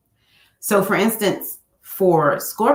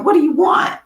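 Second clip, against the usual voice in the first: high, louder exasperated question; annoyed what do you want; irritated; 9 semitones higher, 4 dB louder than usual. Retold or imitated. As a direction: bark it at an interrupting husband. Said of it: "I'm up here working".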